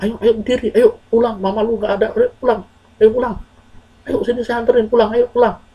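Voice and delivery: high, coaxing, urgent urging; friends urging. Acted. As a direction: hurry a stunned friend home. Friends urge him home. (membujuk dengan nada tinggi)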